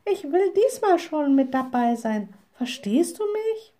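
high, insistent little witch; defiant exclamation, pitched well up; insistent calling out. A narrator's reading. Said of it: "rief die kleine Hexe".

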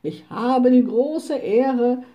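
captain opening his speech to the crowd, pompous. A narrator's reading. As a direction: declaim it like a self-important captain. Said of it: "sagte der Hauptmann".

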